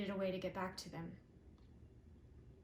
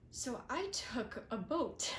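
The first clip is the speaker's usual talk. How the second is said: ordinary talk, nothing marked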